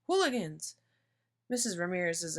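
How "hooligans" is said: higher, lightly raised shout; mild shout. A narrator's reading. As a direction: toss the insult back over a shoulder. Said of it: "he shouts over his shoulder".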